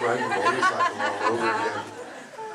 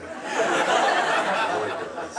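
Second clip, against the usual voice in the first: drawn out, higher and slow; slow emphatic; 8 semitones higher, much slower than usual. Acted. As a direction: stretch it out for the laugh.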